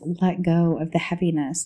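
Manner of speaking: ordinary talk, nothing marked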